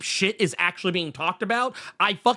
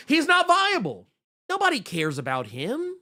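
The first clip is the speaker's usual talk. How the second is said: ordinary talk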